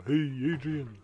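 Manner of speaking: lightly voiced Rocky Balboa; a hint of a Philly boxer drawl. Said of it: "Sound like Rocky Balboa there".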